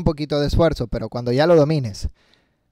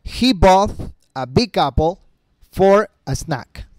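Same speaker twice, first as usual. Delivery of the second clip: slow, deliberate English; slow demo; 3 semitones higher, much slower than usual. Shown as a demonstration. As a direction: spell it out slowly. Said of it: "Por ejemplo". (lento y deliberado)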